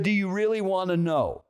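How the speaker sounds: imitating an insistent stranger's challenge; retold pointed challenge. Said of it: "In that tone of voice".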